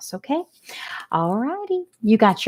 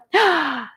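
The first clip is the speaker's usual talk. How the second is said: gasp; 8 semitones higher than usual. Acted. Wilbur hears of shooting.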